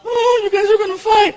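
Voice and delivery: put-on high, whiny kid voice; little kid. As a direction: tattle like a small boy. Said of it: "Frankie walks up".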